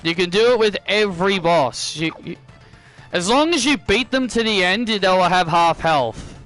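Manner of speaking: ordinary talk, nothing marked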